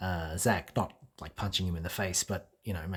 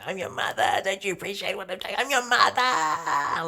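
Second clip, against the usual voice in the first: high, loud unhinged mother shriek; screaming mother; 11 semitones higher, 6 dB louder than usual. Retold or imitated. Drag mother screaming right in her son's face. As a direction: go berserk as the unappreciated mum. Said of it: "so screaming".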